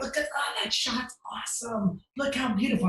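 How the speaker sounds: high, airy mock compliments; fake gushing. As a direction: gush over the photos while searching. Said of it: "And I'm like".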